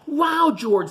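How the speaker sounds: high, pleased wow; light delighted voice; mail carrier delighted. A narrator's reading. Mail carrier thanking George, high and pleased. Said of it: "said the mail carrier".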